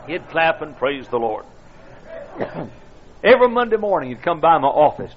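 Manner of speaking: ordinary delivery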